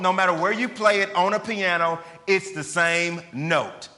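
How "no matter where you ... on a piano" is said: ordinary delivery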